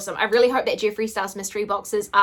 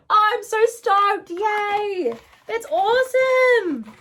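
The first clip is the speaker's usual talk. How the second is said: high and drawn out, slower; stoked squeal; 10 semitones higher, 3 dB louder, much slower than usual. Real, not acted. Vlogger stoked to own both versions, high giddy squeal. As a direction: stretch it out in giddy joy.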